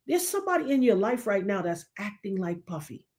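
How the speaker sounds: ordinary talk, nothing marked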